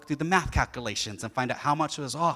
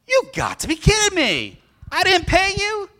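much higher, louder disbelief; imitating his son's shocked disbelief; incredulous kid; 8 dB louder than usual. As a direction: gasp it like a boy who can't believe it. Son shocked about unpaid debt.

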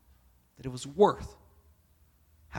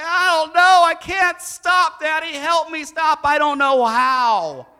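put-on high, loud pleading child voice, slow; loud high little boy begging daddy for help; crying child; 11 semitones higher, 13 dB louder, slower than usual. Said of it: "And he said".

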